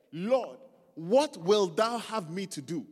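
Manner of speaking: loud, slow scripture cry; astonished plea. A narrator's reading. Preacher reads Saul's answer to the Lord, loud astonished plea. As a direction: read it slowly and forcefully, as if astonished. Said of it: "trembling and astonished".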